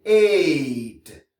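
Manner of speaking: "ate" with long AY and sounded T; English AI plus T. Shown as a demonstration. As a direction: stretch "ate", then release the T. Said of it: "Si on ajoute T". (« ate » allongé, T final prononcé)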